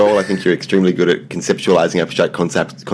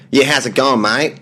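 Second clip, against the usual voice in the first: a light put-on ocker Australian accent, pitched up; 5 semitones higher than usual. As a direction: sketch a broad Australian bloke greeting his mate. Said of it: "I think we call ochre".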